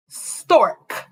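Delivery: Stork with the K clipped sharply at the end; stressed final K. Shown as a demonstration. Teacher stressing the last sound for the class, crisp K. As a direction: land hard on the K of Stork. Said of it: "at the end of stork".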